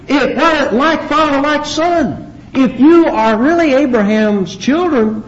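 high-pitched, insistent challenge; forceful rebuke. Acted. Preacher pressing Jesus' challenge to false children, insistent rebuke.

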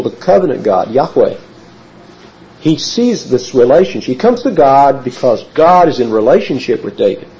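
normal talking voice

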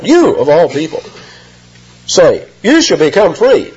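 you of all people pressed with scorn; stress on you. Retold or imitated. Crowd mocking Jesus, stressing you of all people. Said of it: "emphatic pronoun".

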